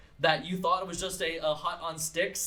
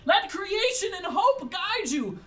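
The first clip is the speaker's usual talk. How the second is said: high, loud, slow farewell call; old woman shouting blessings to the fleeing kids; Narween calling out; 6 dB louder, slower than usual. Acted. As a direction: call out to the kids with fierce hope. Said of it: "you hear Narween say".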